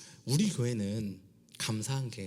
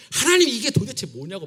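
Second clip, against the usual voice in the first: high, loud exasperated cry at God; angry complaint; 11 semitones higher, 11 dB louder than usual. Retold or imitated. Jonah complaining angrily to God, raised voice. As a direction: throw the frustration up at God. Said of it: "하나님한테 막 화를 내요".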